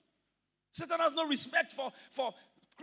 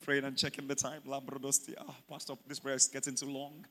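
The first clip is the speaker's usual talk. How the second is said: much lower, soft, bored muttering; mocking lazy churchgoer; 10 semitones lower, 8 dB quieter than usual. Pastor mimicking a churchgoer who checks the time, low and quiet. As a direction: mimic the makeup-worried member. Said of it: "how are we supposed to pray?".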